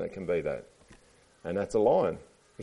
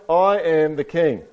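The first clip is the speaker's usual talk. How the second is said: slow, high storybook proclamation; loud tortoise declaration; proud cry; 8 semitones higher, 8 dB louder, slower than usual. A narrator's reading. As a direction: announce it grandly, stretching the words. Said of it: "he cried".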